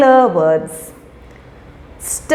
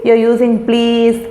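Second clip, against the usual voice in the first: normal talking voice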